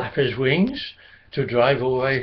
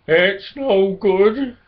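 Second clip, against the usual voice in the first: despairing; 5 semitones higher, 4 dB louder, much slower than usual. A narrator's reading. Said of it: "depths of despair".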